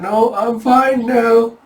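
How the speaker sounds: high, very slow, stretched denial; hammed-up strained reassurance; mock nervous protest. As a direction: drag out an uneasy "I'm fine". Taejun nervously refuses urgent care.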